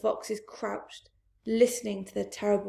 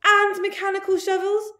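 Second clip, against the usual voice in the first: very loud, very high panicked shout; 10 semitones higher, 10 dB louder than usual. Acted. Mister Fox urging his family to dig, panicked shout. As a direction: scream the order to dig. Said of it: "shouted Mister Fox".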